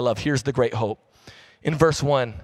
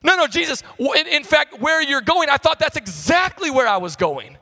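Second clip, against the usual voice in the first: high, loud, rushed protest; excited protest; 11 semitones higher, 4 dB louder than usual. Acted. Disciple protesting to Jesus, high and loud. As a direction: blurt it out like a let-down disciple.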